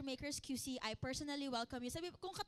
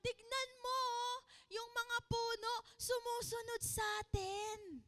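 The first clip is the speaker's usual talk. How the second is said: put-on excited child; 7 semitones higher than usual. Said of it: "Sabi niya".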